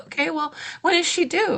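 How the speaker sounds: high, careful probing question; wary question. Retold or imitated. Doula imitates herself checking the due date, wary. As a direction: ask it high and cautious. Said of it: "I was like".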